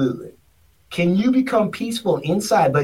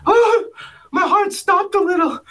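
high-pitched voice; 10 semitones higher than usual. Retold or imitated. Candidate reacting to the boss's threat, squeaky and scared. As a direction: gasp it out like a frightened mouse. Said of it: "he had a high-pitched voice".

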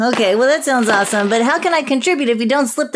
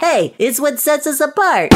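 ordinary talk

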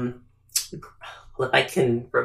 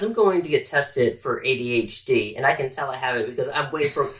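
normal talking voice

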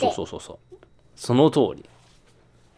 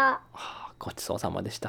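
whisper; 5 semitones lower, 11 dB quieter than usual. Pretend. Whispered thanks after eating.

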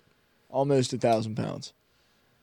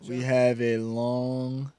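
soft slow showman; much slower than usual. Acted. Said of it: "Ben came in there soft".

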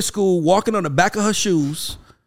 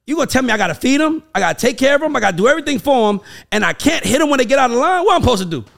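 higher, louder indignant rant; hammed-up indignation; mock outrage; 9 semitones higher, 5 dB louder than usual. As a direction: play up the outraged parent. Indignant rant about hitting kids.

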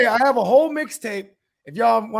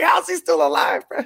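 higher, louder shout of disbelief; incredulous shout; 10 semitones higher, 6 dB louder than usual. Retold or imitated. Watching the movie, shouting in disbelief. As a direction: yell it like you can't believe he's alive. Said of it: "I'm like".

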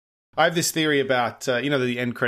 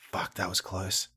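lower, softer muttered relief; inner thought; 5 semitones lower, 7 dB quieter, slower than usual. Retold or imitated. Agent privately relieved after a close call, low soft muttering. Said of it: "I think he was thinking".

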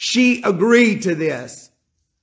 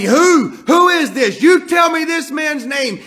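furious shouting, pitched high and loud; David flaring up, demanding the man's name; angry yelling; 9 semitones higher, 4 dB louder than usual. Acted. David yelling at Nathan in a furious shout. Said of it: "I imagine he's yelling out loud".

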